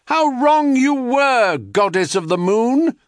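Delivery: high, loud triumphant call; declamatory cry to a goddess. A narrator's reading. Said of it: "they would cry".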